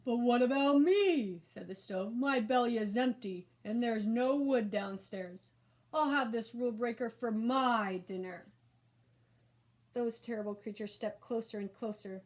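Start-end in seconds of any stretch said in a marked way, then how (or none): 0.0-1.4 much louder, slow, odd creature voice; whiny stove monster. A narrator's reading. 2.1-8.5 whiny stove monster. A narrator's reading.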